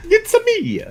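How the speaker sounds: put-on high, squeaky Italian accent. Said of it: "I need to hear the Italian accent".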